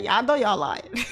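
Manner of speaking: slow and loud through laughter; laughing. Real, not acted. Teasing the chat, laughing loud and slow. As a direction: say it while cracking up.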